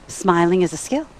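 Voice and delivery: ordinary talk, nothing marked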